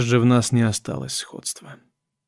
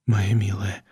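low, soft tender murmur; 4 semitones lower, 6 dB quieter than usual. A narrator's reading. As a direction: murmur it gently, much softer. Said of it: "пробормотал я".

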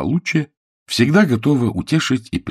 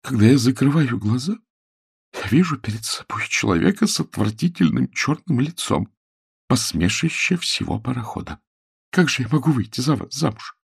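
higher-pitched, hurt lament; trembling voice; 6 semitones higher than usual. A narrator's reading. Woman tells fiancé why she refuses, voice trembling. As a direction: let the distress creep into her voice. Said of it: "голос её задрожал".

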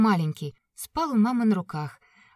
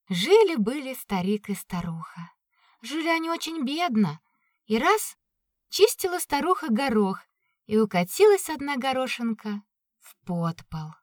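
higher, lightly voiced old storyteller; grandma telling fairy tale; 5 semitones higher than usual. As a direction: tell it like a grandmother at bedtime. Fairy tale told inside story.

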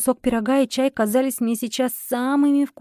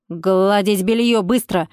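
commanding; 3 semitones lower, slower than usual. A narrator's reading. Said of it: "скомандовала женщина".

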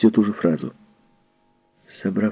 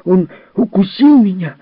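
much higher and louder, a shrieking complaint; furious child shout; 10 semitones higher, 7 dB louder than usual. Acted. A hurt boy shouts at Miss Fellowes, high furious yell. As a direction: scream it out like a bitten little boy. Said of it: "задыхаясь от злости, крикнул Джерри".

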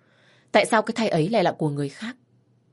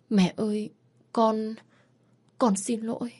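tearful apology; 5 semitones higher than usual. Acted. Frightened daughter apologizes.

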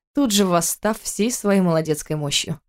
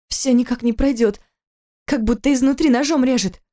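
higher strained complaint; in pain; 6 semitones higher than usual. A narrator's reading. Wife in labour tells husband of pain. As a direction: voice tight with pain.

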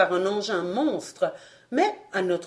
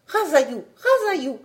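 rising excited chant of a name; alien cry; 8 semitones higher, 4 dB louder than usual. A narrator's reading. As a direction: call out the king's name eagerly. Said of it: "s'écrièrent nos compagnons".

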